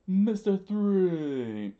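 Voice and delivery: loud, high, drawn-out cry; long theatrical holler; screamed name. Acted. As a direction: scream it slowly, stretching each word. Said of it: "Mister Two screamed".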